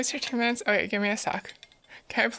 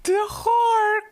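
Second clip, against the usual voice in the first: high, loud, gargling duck rasp; Donald; 10 semitones higher, 4 dB louder, much slower than usual. Pretend. Streamer struggling at a cartoon impression, raspy duck voice. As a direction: squawk it through a raspy throat, badly faked. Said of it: "how to get that raspiness down".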